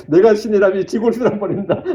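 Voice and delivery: chuckling through a mock threat; laughing retold threat. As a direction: repeat the angry comment with laughter rising. Repeats the comment, laughing. (웃음 섞인 가짜 으름장)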